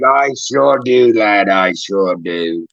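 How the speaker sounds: slow, low British reply; Street's friend's British accent. Acted. The friend answering Street, slow low British accent. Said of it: "And the friend's like".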